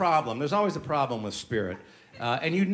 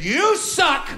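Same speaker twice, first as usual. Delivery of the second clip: mock tweet yell; 11 semitones higher, 5 dB louder than usual. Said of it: "I said".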